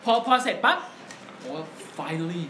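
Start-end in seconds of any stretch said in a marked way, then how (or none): 2.0-2.4 finally said softer and lower, briefly. Shown as a demonstration. Podcaster sets up his childhood promo, softly. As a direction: toss off finally quietly. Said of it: "finally อย่างนี้เลยนะ".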